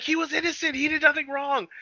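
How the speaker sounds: high, wailing retold plea; hammed-up distraught pleading; mock crying. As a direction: imitate a tearful protest in a high voice.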